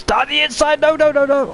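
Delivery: rising panic, pitch shooting up; shocked shout. Real, not acted. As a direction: yell it as the cars nearly touch. Near crash in live race.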